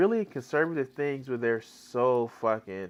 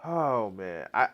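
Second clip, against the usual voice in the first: usual speaking voice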